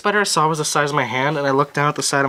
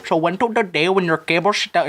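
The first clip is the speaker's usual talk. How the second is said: imitated Indian-accented tech explainer; mock Indian accent; 3 semitones higher than usual. Tech video guy explaining a fix, mock Indian accent. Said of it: "this dude that explains it".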